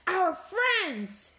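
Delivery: high, loud shocked outburst; horrified storybook exclamation; gasped alarm. A narrator's reading. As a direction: gasp it out in horror. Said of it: "she gasps".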